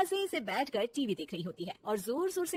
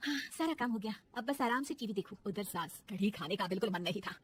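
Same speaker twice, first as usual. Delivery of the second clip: ordinary talk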